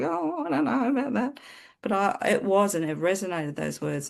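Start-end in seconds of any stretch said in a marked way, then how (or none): 0.0-1.4 doubtful. Retold or imitated. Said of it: "I was like".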